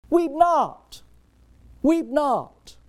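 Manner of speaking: high, loud, ringing command; commanding Jesus voice. Acted. Jesus commanding the grieving mother, high and loud. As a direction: proclaim it with divine authority. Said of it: "Jesus says".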